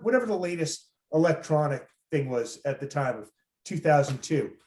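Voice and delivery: usual speaking voice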